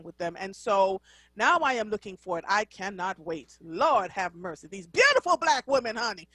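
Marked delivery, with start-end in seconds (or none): none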